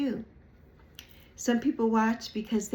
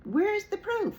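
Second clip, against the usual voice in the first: high, sarcastic demand; mocking challenge; 12 semitones higher than usual. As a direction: toss the question up mockingly.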